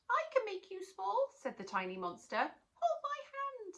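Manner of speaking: very high squeak; tiny monster. Acted. Tiny monster offering to shrink Natalie, high squeak.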